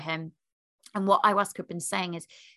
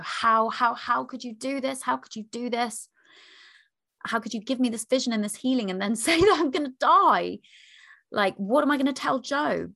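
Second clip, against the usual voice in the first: distraught angry plea; 5 semitones higher, 6 dB louder than usual. Retold or imitated. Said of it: "I was distraught and I was so angry at ayahuasca".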